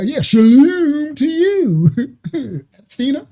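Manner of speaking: high, slow, giggly imitation; amused mock accent; laughing mock accent. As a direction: echo the line while laughing. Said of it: "His accent is crazy work".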